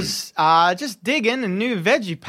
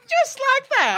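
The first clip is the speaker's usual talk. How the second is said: high farmer voice. Acted. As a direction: jump way up high, excited. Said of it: "he says".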